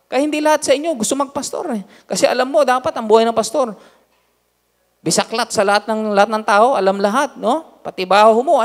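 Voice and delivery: high, loud fervent preaching. Acted. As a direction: drive the point home to the church. Pastor preaching to congregation. (masigasig na pangangaral nang malakas)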